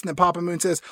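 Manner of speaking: usual speaking voice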